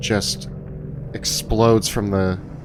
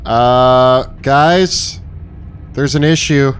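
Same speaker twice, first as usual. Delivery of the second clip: calling out; 2 semitones higher, 4 dB louder, slower than usual. Acted. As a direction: call through to the other room. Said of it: "and he yells".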